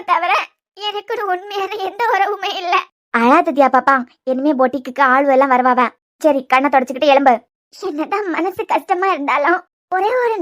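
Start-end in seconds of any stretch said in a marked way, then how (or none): 3.1-7.4 lower, louder motherly helper voice; older maid soothing a crying girl, lower and louder; older helper woman. Acted. Older helper comforting crying Dhiya.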